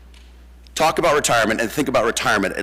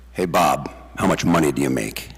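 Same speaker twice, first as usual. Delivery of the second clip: Doug's blunt question; 10 semitones lower than usual. Retold or imitated. Doug leans over to grandson Bob, asking in a lowered voice. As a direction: voice dropped much lower, a light impression of old Doug. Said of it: "he leans over to me and goes".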